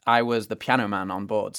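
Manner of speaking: ordinary talk, nothing marked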